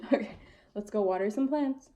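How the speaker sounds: usual speaking voice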